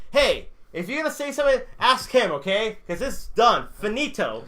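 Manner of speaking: high, loud, emphatic coach rant; worked-up retelling of the coach's outburst; fired-up coach. Retold or imitated. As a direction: imitate a coach laying down the law. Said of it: "the coach got fired up too".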